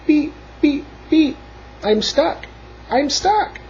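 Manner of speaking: high beeps and cries; crying out, stuck. A narrator's reading. Blue calling the animals for help, high cries. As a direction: squeak it out high like a little horn. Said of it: "cried the Little Blue Truck".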